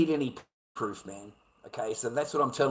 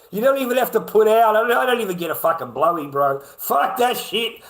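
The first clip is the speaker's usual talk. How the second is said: angry ranting; 2 semitones higher, 6 dB louder than usual. Real, not acted. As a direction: let the grievance boil over into shouting. Ranting, shouting about no sex.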